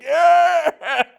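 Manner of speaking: huge high mock cheer; sarcastic cheer. Union workers mocking the fallen newcomer, loud, high sarcastic cheer. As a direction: whoop like a crowd jeering. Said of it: "sarcastically cheering me on".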